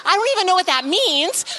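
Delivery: high, puzzled protest; mock bafflement. Speaker reacts to client calling it her thing, mock bafflement.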